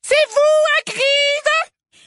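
piercingly high, drawn-out shout; slow and very loud. A narrator's reading. Umbridge addresses Hagrid, slow and very loud. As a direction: speak as if to a deaf person. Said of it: "en parlant très fort comme si elle s'adressait à un sourd".

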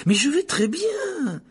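high, drawn-out, loud objection; indignant protest. A narrator's reading. Boy leaping up to refuse the infirmary, indignant. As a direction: protest slowly and loudly, pitch shooting up. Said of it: "protesta Harry en se levant d'un bond".

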